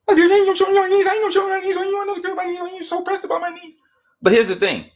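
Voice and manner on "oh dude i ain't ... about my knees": very high falsetto, fading in volume; high-pitched whiny mockery; mock protest. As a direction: mimic a squeaky, pestered opponent refusing. Acting out opponent's refusal in argument.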